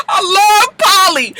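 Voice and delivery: high, slow, loud laughing cry; real delighted yelp; laughing shout. Real, not acted. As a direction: gush it through laughter.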